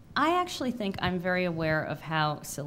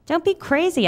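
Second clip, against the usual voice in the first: high, loud brush-off; dismissive; 11 semitones higher, 10 dB louder than usual. Retold or imitated. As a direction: wave the worry away, sharply. Brushing off friend's worry.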